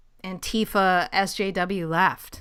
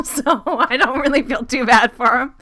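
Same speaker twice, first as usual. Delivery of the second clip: laughing through the words, louder; 4 semitones higher, 8 dB louder than usual. Real, not acted. Host to co-host, laughing while he talks. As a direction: chuckle it out.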